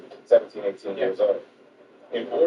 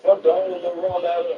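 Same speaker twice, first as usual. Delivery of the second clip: higher, punchy exclamation; mock warning; 10 semitones higher, 3 dB louder than usual. Warning Craig about ruining the rollout, high and emphatic. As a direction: hit fuck hard like a warning.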